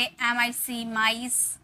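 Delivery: slow clear model words, long आई sound; drawn-out model words. Shown as a demonstration. Teacher slowly modelling words with the आई sound. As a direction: stretch vice and nice slowly. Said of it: "और word हो सकते हैं जैसे".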